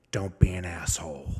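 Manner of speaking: whispered stern advice; low whispered mentor's words; hushed quote. Retold or imitated. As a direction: breathe it like a secret warning.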